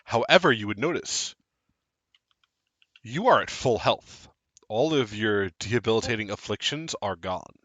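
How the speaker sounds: scene narration. Acted. Game master narrates the scene.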